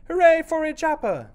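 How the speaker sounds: high, loud crowd cheer. Acted. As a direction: cheer for the hero. Animals cheer at the feast.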